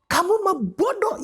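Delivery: high mocking scold of oneself; harsh inner critic. Preacher voicing the harsh inner critic, mocking. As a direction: sneer at yourself as worthless. Scolding self-judgment voice acted.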